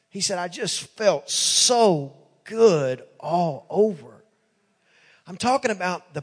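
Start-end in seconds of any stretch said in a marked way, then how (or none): 0.4-4.2 very slow retold child's testimony; heartfelt, drawn out. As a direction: savor it slowly, acting the moved boy. Retold weeping son describes divine feeling.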